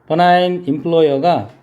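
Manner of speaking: ordinary talk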